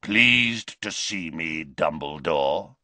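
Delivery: slow, low leering taunt; menacing werewolf. Acted. Greyback to Dumbledore, a slow leering taunt. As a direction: savour the threat.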